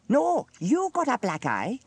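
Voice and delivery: high, slow "No, you", loud; emphatic contradiction. A narrator's reading. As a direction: throw it back, leaning on "you". Dilys contradicts Sam.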